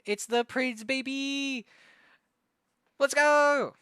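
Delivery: rising hype, then a big shout; excited cheer. Real, not acted. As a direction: cheer him on loud and high.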